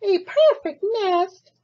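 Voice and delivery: high goose voice; delighted goose. Acted. Goose claims the nest in a high honk. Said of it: "she cried".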